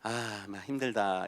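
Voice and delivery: slow, low, very soft sigh of tiredness; weary self-talk. Acted. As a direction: drag it out wearily under your breath. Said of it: "이런 기분 드십니까?". (지친 듯 낮고 느리게)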